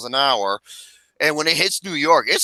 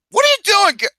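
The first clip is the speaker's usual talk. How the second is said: retold shout; 5 dB louder than usual.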